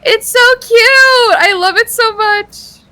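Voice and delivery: high, loud and slow gushing. Real, not acted. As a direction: squeal over how cute it is. Gushing over cute vine.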